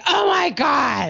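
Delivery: imitating an exasperated daughter, high and slow; mock teen outcry, drawn out high. Daughter reacts to mom fumbling with Siri, exasperated. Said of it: "And she's like".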